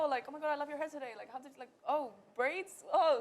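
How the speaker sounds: gushing fake compliment. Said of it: "it's always like".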